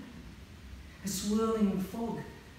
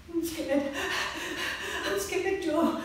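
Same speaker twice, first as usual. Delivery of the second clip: scared; 9 semitones higher than usual. Acted. Georgie frightened while running.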